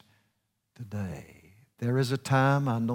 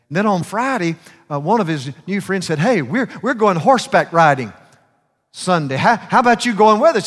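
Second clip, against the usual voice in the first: excited invitation; 7 semitones higher, 10 dB louder than usual. Retold or imitated. Excited friends inviting him riding.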